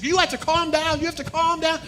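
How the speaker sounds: high, loud, urgent nurse telling him to calm down. Retold or imitated. Nurse voiced in story.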